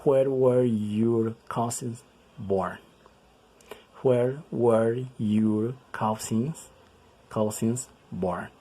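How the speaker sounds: drawn-out, word by word; teacher reading an example very slowly; very slow model reading. Shown as a demonstration. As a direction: stretch each word for learners. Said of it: "Y el último".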